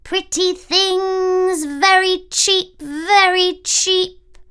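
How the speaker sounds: put-on high, slow, loud old crone calling; disguised queen as an old peddler woman; peddler's cry. A narrator's reading. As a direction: hawk your wares at the door.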